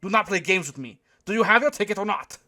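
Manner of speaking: put-on high, loud, irritated German accent; snapping. A narrator's reading. German usher to the young man, irritated snap. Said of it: "I heard the German snap at the young man".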